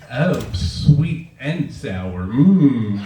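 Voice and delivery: leering slow come-on with a loud mmm; slow, sleazy purr of a creep; drawn-out leering pickup line; creepy old manager. Retold or imitated. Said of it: "he goes".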